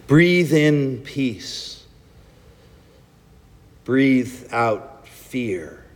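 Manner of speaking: slow, calm prayer with long pauses; guided breathing prayer. Acted. Pastor leading the congregation in breathing prayer, slow and calm. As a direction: lead them gently through each breath.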